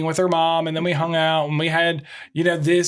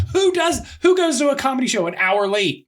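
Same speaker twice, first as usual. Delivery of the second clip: indignant; 10 semitones higher than usual. Real, not acted. Venting about relatives' lateness.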